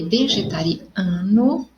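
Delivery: vegetariano said slowly, syllable by syllable; slowed demo word. Shown as a demonstration. Teacher showing students the suffix, slowly. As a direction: stretch out vegetariano so the ending ano stands out. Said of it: "eu acrescentei ano no final".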